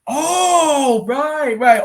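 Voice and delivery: long high "oh" sliding down; the penny drops, high and drawn out; high-pitched moment of getting it; dawning realization. Real, not acted. Realizes own mistake.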